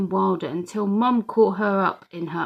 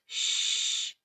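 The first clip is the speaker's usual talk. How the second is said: hushing whisper; 9 dB quieter than usual. A narrator's reading. Telling Mum to hush.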